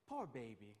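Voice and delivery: affectionate pity. Shown as a demonstration. Pastor showing the tone of Jesus' words as affection, tender. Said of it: "it's actually a term of affection".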